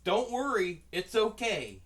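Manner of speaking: loud, high, hammed-up comforting; over-eager reassurance. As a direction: reassure her far too loudly. Retold loud reassurance to cashier.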